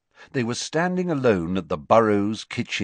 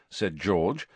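usual speaking voice

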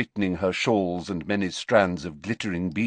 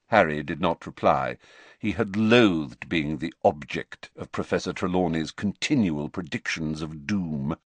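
usual speaking voice

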